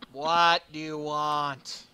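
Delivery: loud, drawn-out exasperation; slow, loud, weary demand; exasperated. Acted. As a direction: stretch each word out, fed up. Acted exasperated reply after facepalm.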